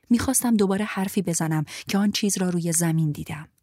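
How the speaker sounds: plain reading voice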